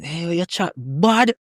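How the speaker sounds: slow, drawn-out Kingston patois jab. Retold or imitated. Kingston people teasing his patois, in slow Kingston patois. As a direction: drawl it like a Kingston local teasing. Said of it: "people from Kingston".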